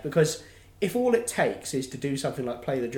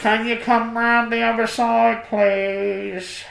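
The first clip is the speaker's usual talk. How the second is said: higher, louder, put-upon call across the shop; a tired till woman calling to the queue; weary shop worker; 8 semitones higher, 6 dB louder, slower than usual. Retold or imitated. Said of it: "creak out of her little seat and go".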